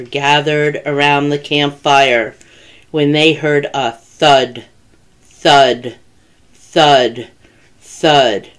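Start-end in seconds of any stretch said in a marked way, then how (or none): 3.9-8.5 low, heavy, evenly spaced thuds; ominous thuds. A narrator's reading. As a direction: drop each word like a heavy footstep. Narrator imitates heavy approaching footsteps.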